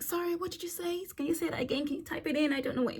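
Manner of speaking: high, soft, feigning confusion; 1 coy flirting. Retold or imitated. Tourist keeping the handsome helper talking, coy and soft. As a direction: play dumb to keep him talking. Pretends not to hear, to flirt.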